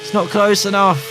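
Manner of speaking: high, urgent play-by-play; excited race commentary. Acted. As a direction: call the race live, pitched high and building to the win.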